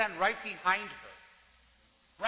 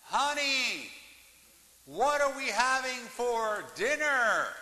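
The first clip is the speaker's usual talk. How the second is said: raised, calling upstairs, higher; 6 semitones higher, 4 dB louder, slower than usual. Retold or imitated. Husband calls upstairs to wife, raised calling voice. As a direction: call up the stairs to his wife. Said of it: "from the second floor he said".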